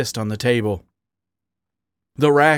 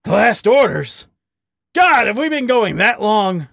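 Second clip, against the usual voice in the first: louder, higher, incredulous question; startled grunt; 9 semitones higher, 4 dB louder than usual. A narrator's reading. Badger hearing closing time called, startled and incredulous. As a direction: react in disbelief at the hour. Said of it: "Harry grunted".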